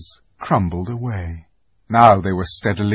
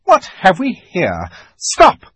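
much higher and louder, building to a shrieked stop; a cold queen spotting intruders, then barking halt; witch's challenge; 8 semitones higher, 8 dB louder than usual. Acted. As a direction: witch rising into a shrill command. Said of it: "the witch said".

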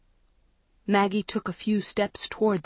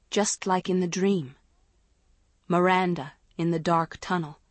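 ordinary delivery, nothing marked

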